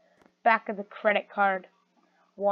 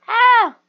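loud high scream; 13 semitones higher, 12 dB louder than usual. Acted. Discovering Robux stolen.

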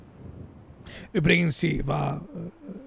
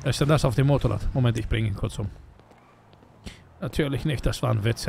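normal talking voice

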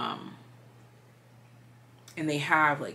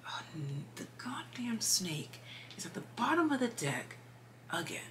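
high whispered disbelief; exasperated whisper; 6 semitones higher, 7 dB quieter than usual. Real, not acted. Reader finds the snake card at the bottom again, whispered.

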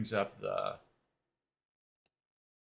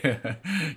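chuckle; 7 semitones higher, 4 dB louder than usual. Real, not acted. Laughing at own joke.

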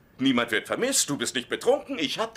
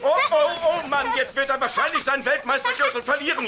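hyped ringside announcer, much higher; wrestling commentator; 13 semitones higher, 6 dB louder than usual. Pretend. Father play-wrestling with his son, hyped announcer voice. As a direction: call the match like a TV wrestling host.

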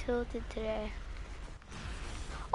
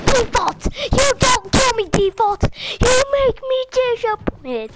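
high, loud, hammed-up outburst; mock tirade; 9 semitones higher, 4 dB louder than usual. Gamer berating a default player, in a high mock-outraged yell. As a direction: cry it out high and loud, drawing out the end.

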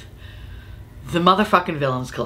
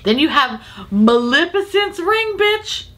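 excited reveal; 10 semitones higher, slower than usual. Real, not acted.

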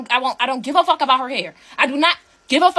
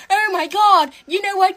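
higher-pitched mocking imitation; mocking quote; 5 semitones higher than usual. Quoting Shira Savin to the sisters, mocking. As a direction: mimic her in a higher voice. Mock quote of another woman.

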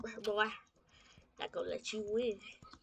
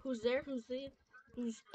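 ordinary delivery

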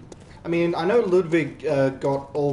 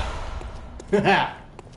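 triumphant laugh; 4 semitones higher than usual. Real, not acted. After the kill.

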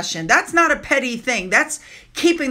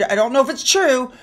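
ordinary talk, nothing marked

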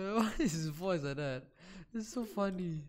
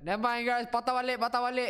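high, loud mock panic; high-pitched mocking cry; mock teammate; 9 semitones higher, 6 dB louder, faster than usual. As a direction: squeal it like a flustered teammate.

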